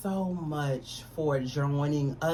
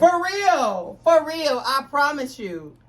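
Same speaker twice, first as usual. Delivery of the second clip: excited; 10 semitones higher, 6 dB louder, slower than usual. Real, not acted. Excited about reunion tour.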